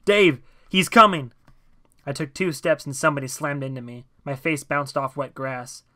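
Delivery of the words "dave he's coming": loud, high, drawn-out yell; yelled warning. A narrator's reading. John fleeing through the crowd to Dave, yelled warning. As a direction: yell a warning back over your shoulder. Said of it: "Over his shoulders he yelled".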